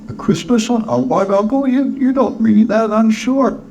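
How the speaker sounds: high-pitched nephew, taken aback; cheerful nephew, protesting. Acted. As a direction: protest in a high, youthful character voice. Nephew's voice in the story.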